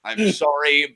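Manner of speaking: normal talking voice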